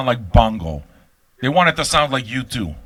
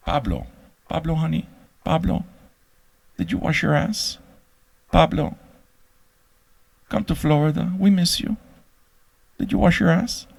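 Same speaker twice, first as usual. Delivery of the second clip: mock doting mother; 4 semitones higher than usual. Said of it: "I hated Pablo, honey".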